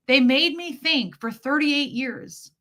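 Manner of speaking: ordinary delivery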